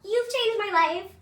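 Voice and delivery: high, slow, breathy fan gratitude; high sweet thank-you of adoring fans; grateful girls' voice. Retold or imitated. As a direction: lift it up high like starstruck young girls. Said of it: "and they're like".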